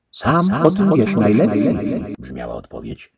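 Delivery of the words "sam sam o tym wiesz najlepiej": wysoko i głośno, obco brzmiący; głos głowy. A narrator's reading. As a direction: zbywa pytającego. The head answers. (głowa zbywa szlachcica)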